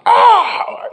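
shrill piercing bird cry; sudden bird screech. Shown as a demonstration. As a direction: let out a sharp screeching raven call. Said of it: "Sorry to scare you guys".

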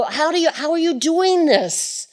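high, wondering question to a suffering friend; marvelling onlooker, pitched high; amazed onlooker. Retold or imitated. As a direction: ask in disbelief how they stay calm. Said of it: "you go".

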